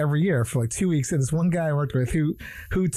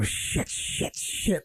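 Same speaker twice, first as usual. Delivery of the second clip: under-the-breath swearing, softer; muttered curse; 7 dB quieter, much slower than usual. Retold or imitated. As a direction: mutter it in annoyance. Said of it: "he'd always go".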